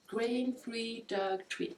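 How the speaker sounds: slow, careful French-accented English. Real, not acted. Reading the treat package, careful French-accented English. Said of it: "faire attention à mon accent".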